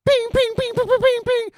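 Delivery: high, loud "ping" ricochet noises; bullet ricochet pings. Retold or imitated. Bullets bouncing off the robot's armor, high pinging. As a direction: sound out bullets pinging off metal.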